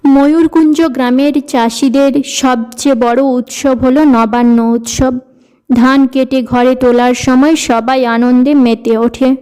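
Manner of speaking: usual speaking voice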